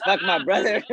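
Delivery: laughing quote. Retold or imitated. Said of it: "he says".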